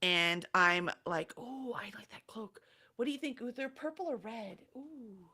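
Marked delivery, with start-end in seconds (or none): 1.4-5.2 very soft, half-whispered cooing; hushed admiration. Acted. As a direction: murmur admiringly to a companion while browsing. Character admiring cloak, asking companion.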